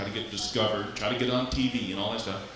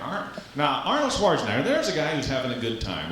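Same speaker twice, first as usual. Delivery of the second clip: normal talking voice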